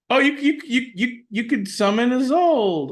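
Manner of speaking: excited discovery, voice jumping up; really excited. Real, not acted. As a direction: stumble over "you" in a rush of real excitement. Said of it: "You sound really excited".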